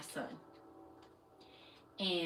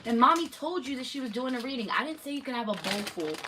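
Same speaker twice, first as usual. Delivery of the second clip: quick, higher, exasperated telling-off; fast scolding; 6 semitones higher, 9 dB louder, faster than usual. Real, not acted. Mom scolds kid over snack.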